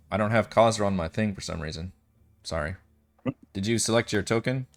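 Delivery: ordinary talk, nothing marked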